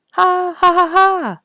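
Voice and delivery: high, loud hearty bird laugh. Acted. Robin Red greeting the bluebirds, loud high laugh. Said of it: "laughed Robin Red".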